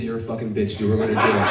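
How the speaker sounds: low, subdued deadpan; resigned deadpan. Acted. Boyfriend learning the gun is unregistered, low resigned deadpan. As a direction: drop the voice and concede defeat.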